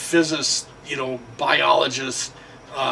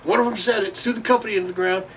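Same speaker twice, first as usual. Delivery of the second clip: higher hammed-up gloating voice; mock smug reply; 8 semitones higher than usual. Worker boasting of his recording, high mocking voice. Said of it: "They said".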